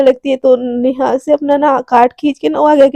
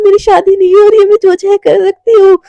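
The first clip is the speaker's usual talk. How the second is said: excited; 6 semitones higher, 6 dB louder than usual. Retold or imitated. Radhika rejoices at freedom.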